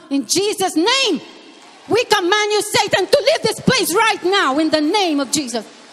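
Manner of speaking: preacher commands Satan to leave the room, forceful; commanding prayer. Real, not acted. (malakas at mariing pag-uutos kay Satanas)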